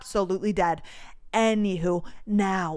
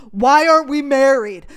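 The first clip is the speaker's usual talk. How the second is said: loud, exasperated cry; fed-up loud wail; 5 semitones higher, 11 dB louder than usual. Retold or imitated. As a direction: throw it up to the sky in frustration. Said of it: "where I'm like".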